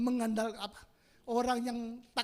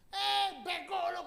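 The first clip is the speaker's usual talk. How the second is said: high, drawn-out mock scolding; imitated angry woman; 8 semitones higher, 5 dB louder, slower than usual. Preacher imitating angry woman in the bajaj, mock scolding. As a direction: mimic an indignant passenger calling out an insult. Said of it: "Eh, ibu-ibu marah".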